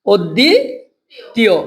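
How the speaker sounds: slow "অদ্বিতীয়", "দি" drawn out and rising, pause, then "তীয়"; broken into syllables. Shown as a demonstration. Teacher spelling by sound for students, "অদ্বিতীয়" slowly split in two. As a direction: stretch "অদ্বি" upward, stop, then drop on "তীয়". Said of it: "এখানে থামছি না".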